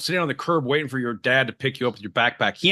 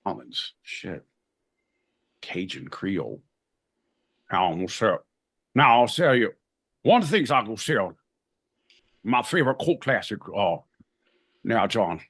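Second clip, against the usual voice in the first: put-on Cajun accent; 4 semitones lower than usual. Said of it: "New Orleans".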